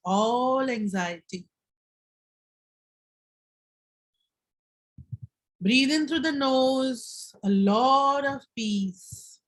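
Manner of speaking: slow, calm breathing instructions with long pauses; guided meditation. Acted. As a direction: lead them gently through each breath.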